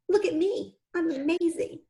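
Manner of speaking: mother imitating her daughter's pride; proud little girl. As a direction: lightly voice a proud young daughter. Mother voices proud young daughter.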